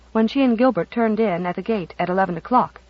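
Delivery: plain reading voice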